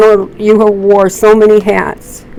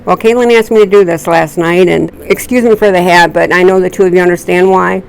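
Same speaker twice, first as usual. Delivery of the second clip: ordinary talk, nothing marked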